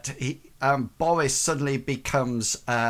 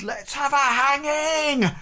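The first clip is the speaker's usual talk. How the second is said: high, gleeful mob cry; heckler in the crowd; 13 semitones higher, 3 dB louder than usual. Acted. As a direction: whip up the crowd with a high yell. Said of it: "and somebody says".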